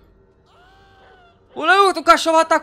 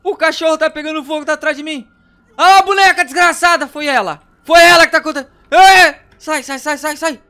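being chased; 3 semitones higher, 8 dB louder than usual. Real, not acted. Tá atrás de mim.

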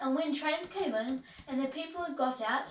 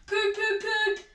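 high voiced train toots; engine whistle toots; 8 semitones higher, 4 dB louder, slower than usual. A narrator's reading. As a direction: imitate a locomotive's whistle. Gordon's engine whistle imitated.

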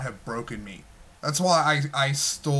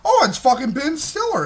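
eager moviegoer; 12 semitones higher, 6 dB louder than usual. Retold or imitated. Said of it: "'cause it's like".

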